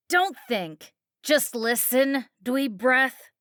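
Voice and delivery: put-on bully the kid like a spiteful mom; coked-up mom taunting her daughter at the wheel; mocking drunk mother. A narrator's reading. Christy snapping.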